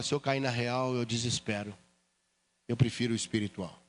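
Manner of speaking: low, soft reply to the scoffer; quiet retort. Retold or imitated. The believer answers the scoffing brother, quiet and low. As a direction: say it softly, almost to yourself.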